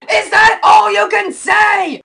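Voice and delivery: loud, high, drawn-out yell; shrieked outburst of frustration; exasperated shout. Acted. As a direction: explode at the cheerful host. Exasperated at cheerful host.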